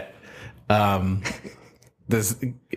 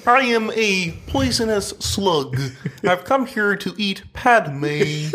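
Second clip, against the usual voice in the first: higher, slowed cartoon slug voice; Kermit voice; 7 semitones higher than usual. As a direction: play it as a frog-like puppet slug. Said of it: "Kermit's slug, sorry".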